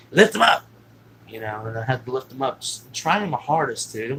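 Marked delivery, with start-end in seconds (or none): 0.1-0.6 high, loud yelled command; yelling. Retold or imitated. Dad ordering his struggling child, yelling. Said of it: "yelling at me".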